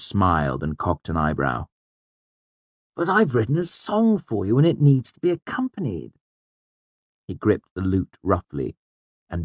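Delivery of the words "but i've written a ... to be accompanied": high, theatrical mock-innocent taunt; showy, sneering bully's protest. A narrator's reading. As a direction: taunt in a high, swooping put-on voice. Ambrose mockingly taunts the narrator.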